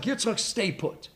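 slow, hushed stay put; quiet command. Acted. Hashem quietly telling Yitzchak to stay put, slow and hushed. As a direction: hold him in place softly.

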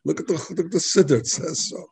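high, indignant mock protest; hammed-up indignant questioner; upset retort. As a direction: play the outraged student scoffing. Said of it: "The guy got so upset".

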